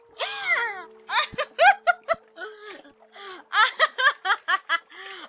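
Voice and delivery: loud, high peals of laughter; real long laughing fit, nearly crying; helpless laughter. Real, not acted. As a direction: burst out in high cackling laughs. Bursts out laughing at own accent.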